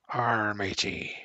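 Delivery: stretched-out pirate arr, drawn slowly; hammed-up slow pirate greeting; slow pirate drawl. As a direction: drag out the arr like a lazy old pirate.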